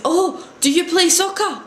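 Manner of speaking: high-pitched mock Japanese accent; imitated Japanese accent. Japanese teacher asking the visitor about sport, imitated Japanese accent. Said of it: "I can't say it right 'cause obviously I'm not Japanese".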